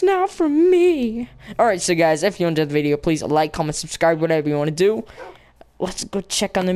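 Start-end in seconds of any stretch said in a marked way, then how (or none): 0.0-1.3 high, drawn-out mock protest; playful high whine. Player disowning dog's teleport powers, high mock protest. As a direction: stretch it out high, teasingly.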